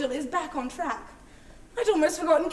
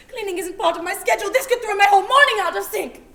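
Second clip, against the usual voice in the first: louder, fast, fussy outrage; indignant rant; 5 semitones higher, 9 dB louder, faster than usual. Acted. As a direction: rant as if the schedule is sacred. Outrage at broken schedule.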